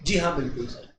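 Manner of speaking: low, soft obedient student; meek student saying yes sir; student reply. Acted. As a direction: mumble agreement like a meek pupil. Teacher voicing student replies.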